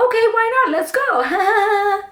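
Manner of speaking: high, bright-eyed eager yes; naive eager self. Retold or imitated. Recalling her naive thoughts about the strip club, eager high voice. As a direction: play her younger, clueless self jumping at the idea. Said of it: "my naive ass thought".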